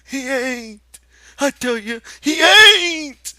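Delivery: high, loud, tearful denial; Lenny near tears. Acted. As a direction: sob it out in denial. Lenny crying, ears covered.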